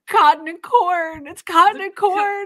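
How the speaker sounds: high, loud protest under interrogation; tortured captive. Acted. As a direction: wail it desperately at the torturers, hammed up.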